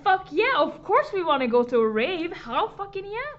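loud, high whooping yes; excited cheer. Retold or imitated. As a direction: cheer it like a thrilled teen. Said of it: "we were like".

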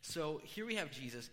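ordinary talk, nothing marked

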